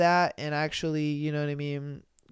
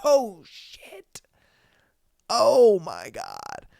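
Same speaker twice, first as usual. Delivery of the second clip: shocked; 3 semitones higher, much slower than usual. Retold or imitated. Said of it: "And was like".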